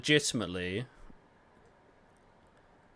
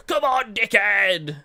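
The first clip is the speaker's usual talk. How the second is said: loud, high, drawn-out shout at the screen; sports fan yell; 8 semitones higher, 8 dB louder, slower than usual. Acted. Player yelling at his team about to score, shouted. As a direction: yell it like an exasperated football fan. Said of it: "What if I yell at my monitor?".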